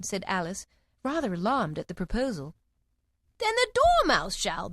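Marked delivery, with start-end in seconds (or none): 3.4-4.7 high, slow cry. A narrator's reading. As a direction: call it out high and drawn. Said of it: "they both cried".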